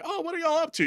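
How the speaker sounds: high mock George; hammed-up curious George. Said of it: "George was like".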